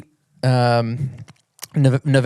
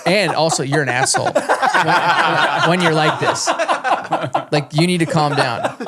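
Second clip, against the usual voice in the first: high squeaky mock voice of the wife, laughing; teasing falsetto wife scolding, giggling; 4 semitones higher than usual. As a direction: laugh through a high-pitched impression of the wife. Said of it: "My wife's like".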